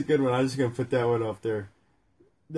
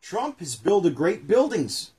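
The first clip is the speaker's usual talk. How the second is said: read aloud slowly; 4 semitones higher, 3 dB louder, slower than usual. Acted. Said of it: "reading the book aloud".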